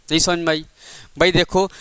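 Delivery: ordinary talk, nothing marked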